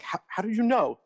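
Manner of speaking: high, astonished question; amazed disbelief. Retold or imitated. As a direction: marvel at how they knew. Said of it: "And I'm like".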